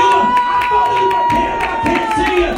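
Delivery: calling out. Retold or imitated. Man calling out to Jesus.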